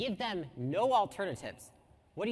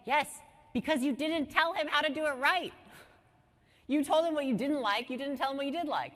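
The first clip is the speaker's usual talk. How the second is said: louder, higher exasperated comeback; pointed, raised-voice correction; emphatic retort; 6 semitones higher, 4 dB louder than usual. Acted. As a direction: fire back as if it were obvious. Answering an imagined frustrated manager.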